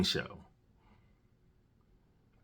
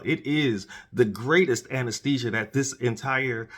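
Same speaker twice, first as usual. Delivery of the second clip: ordinary talk, nothing marked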